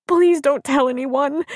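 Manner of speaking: high, slow pleading wail; tearful. A narrator's reading. Crying woman begs friend's husband for secrecy, tearful. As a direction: beg tearfully with stressed words. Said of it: "Abby stressed tearfully".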